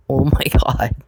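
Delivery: breathy whispered laugh mid-phrase. Real, not acted. Reacting to Perry mocking her, a whispered laugh. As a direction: laugh it out under your breath in disbelief.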